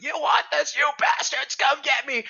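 high shouted taunt; defiant yell. Retold or imitated. Lone man shouting at the machines, high and defiant. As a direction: bellow it at the whole swarm. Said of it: "He's like".

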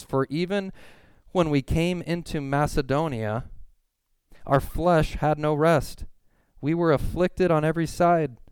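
high, intense preaching read; forceful scripture reading. Acted. Preacher reading Paul's letter to the congregation, forcefully. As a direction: proclaim the verse with mounting urgency.

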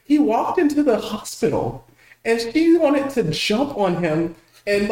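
usual speaking voice

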